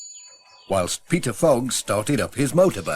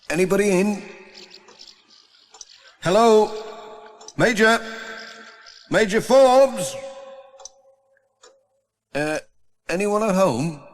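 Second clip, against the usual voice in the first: higher, louder calling into an empty house; 10 semitones higher, 3 dB louder than usual. Acted. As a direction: call up the stairs to find someone. Pat calling through empty house.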